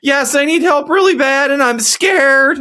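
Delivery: high put-on pleading voice; imitated scared woman. Woman answering through the hotel door, imitated high scared plea. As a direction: plead in a high voice, stretching the ending.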